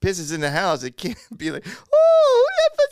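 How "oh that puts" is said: very high, loud gushing pet-owner voice; mock dog cooing. Mocking friend finding dog mess, high gushing coo. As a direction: coo at the dog in exaggerated falsetto.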